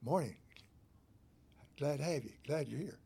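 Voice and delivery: kind greeting. Shown as a demonstration. Preacher demonstrates a kind greeter, soft and gentle. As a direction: greet them kindly and quietly. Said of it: "You see the difference?".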